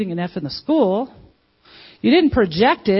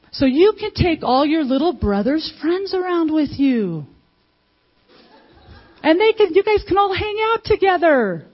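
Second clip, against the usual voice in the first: higher, louder cheerful parent voice; bright encouraging parent; 8 semitones higher, 3 dB louder than usual. Retold or imitated. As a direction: hype the kid up like a proud parent. Parent voicing cheerful reply to kid.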